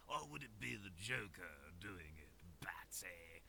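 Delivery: lightly voiced Joker, a hint of menace; barely-there Joker voice; awful Joker impression. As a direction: tease Batsy like a half-hearted Joker. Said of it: "Awful Joker impression".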